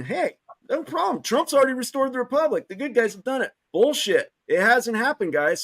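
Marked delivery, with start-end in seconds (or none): 0.0-3.5 much higher, breezy mimicry of complacent people; mock complacency. Mimicking people who sit back and think it's handled, mock complacency. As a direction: imitate them as blithely unconcerned, pitched up. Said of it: "everybody's sitting on their asses thinking".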